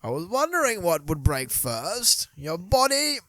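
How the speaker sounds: put-on slow, theatrical Bane British accent. Bane accent impression for his friends. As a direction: drawl it like Bane. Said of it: "that's what he sounds like".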